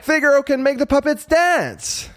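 put-on high-pitched boyish call for attention; excited puppet boy. A narrator's reading. Pinocchio showing his father a surprise, excited. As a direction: call him over, high and eager.

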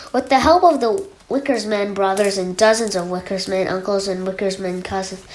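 ordinary delivery

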